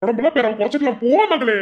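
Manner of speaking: loud, high-pitched scolding uncle. Acted. Uncle loudly scolding his niece to go eat. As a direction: bark at her to go eat.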